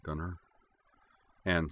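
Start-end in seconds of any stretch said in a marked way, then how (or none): 0.0-0.4 much softer, drowsy and far away; distant and blurred. A narrator's reading. Anne to Gunnar, drowsy, softly blurred. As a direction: Anne murmurs sleepily from the edge of sleep. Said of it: "sounding a little distant and somewhat blurred".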